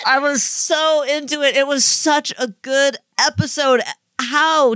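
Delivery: high-pitched, overwhelmed gushing. Real, not acted. Host confessing love of the episode to co-host, gushing. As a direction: gush as if near tears over it.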